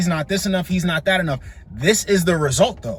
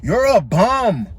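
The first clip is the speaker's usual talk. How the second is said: mocking insult; much slower than usual. Host mockingly insults her.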